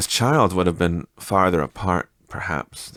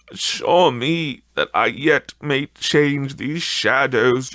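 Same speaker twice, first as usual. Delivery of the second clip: put-on desperate old miser begging, raised and slowed; Scrooge pleading; 4 semitones higher, 3 dB louder, slower than usual. Scrooge begging the silent spirit at his own grave, desperate pleading. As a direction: plead for your life, louder and dragging the words.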